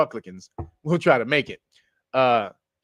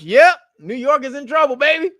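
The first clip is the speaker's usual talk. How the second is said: high, loud gleeful taunt; gleeful quote; 11 semitones higher, 8 dB louder than usual. Retold or imitated. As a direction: crow it like you're loving the bad news. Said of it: "Gerald Jokes".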